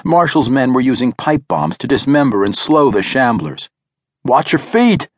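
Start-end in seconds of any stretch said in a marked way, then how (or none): 4.2-5.1 higher-pitched warning call. A narrator's reading. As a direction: call it out to people nearby. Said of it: "Victor called out".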